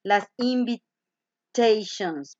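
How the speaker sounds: invitations said very slowly in English. Shown as a demonstration. Teacher introducing the word invitations. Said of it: "la otra parte".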